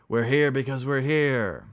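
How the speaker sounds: slow, loud, high bellow; bull-like bellow. Acted. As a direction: bellow it with drawn-out words. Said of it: "bellowed like a bull".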